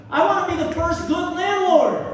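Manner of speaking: high, loud comic rant; grand proclamation. Acted. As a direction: shout it out as a proud declaration. Comic rant, declaring a grand ambition.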